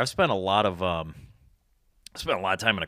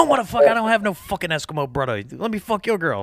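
put-on Mike's accent; 9 semitones higher than usual. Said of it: "his accent is so fucking heavy".

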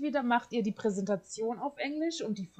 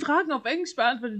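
normal talking voice

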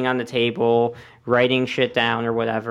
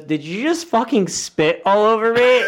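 angry celebrity; 9 semitones higher, 3 dB louder than usual. Retold or imitated. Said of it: "and he goes".

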